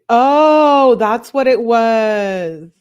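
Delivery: loud, drawn-out "oh" of discovery; the penny drops, loudly; sudden realization. Real, not acted. Sudden realization on reading comments.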